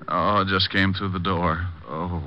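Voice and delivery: slow, low groaning reply; dazed, groggy. Acted. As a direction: mumble it as you come round. Coming round after being knocked out.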